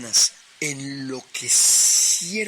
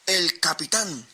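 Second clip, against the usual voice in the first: ordinary delivery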